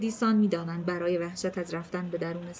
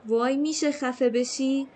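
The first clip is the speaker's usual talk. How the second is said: exasperated retort; 6 semitones higher, slower than usual. A narrator's reading. Annoyed retort to Mal's teasing.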